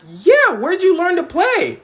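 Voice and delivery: high, loud, eager question; amazed. Retold or imitated. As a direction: gush over the kid's playing. Amazed at the pianist.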